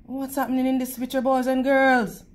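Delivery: ordinary delivery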